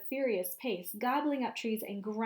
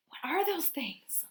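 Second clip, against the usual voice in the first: scared whisper; 6 semitones higher than usual. Pretend. Asking about monsters.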